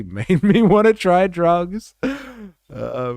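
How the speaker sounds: laughing loudly through the words. Real, not acted. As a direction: burst out laughing while saying it. Laughing at the drug program.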